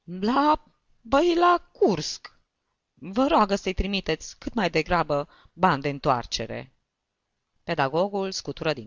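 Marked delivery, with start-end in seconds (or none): 0.0-6.8 tearful wail. A narrator's reading. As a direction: wail the place name, then ease down. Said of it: "Își șterse ochii". (vaiet plângăreț, tărăgănat)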